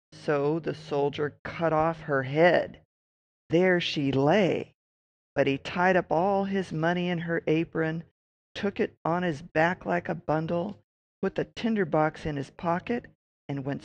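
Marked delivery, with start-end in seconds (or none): none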